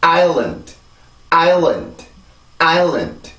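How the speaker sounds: island as EYE-lund, big beat then small beat; English two-syllable rhythm. Shown as a demonstration. Teacher modelling for repetition, island as two-beat EYE-lund. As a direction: stress island's first vowel, end on a bare D. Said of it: "이 단어는 그렇게 발음을 해요".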